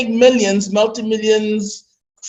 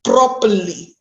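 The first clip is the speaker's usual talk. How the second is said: normal talking voice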